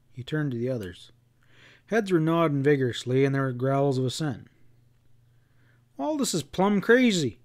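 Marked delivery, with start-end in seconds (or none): 6.0-7.4 protesting. A narrator's reading. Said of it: "Pat protested".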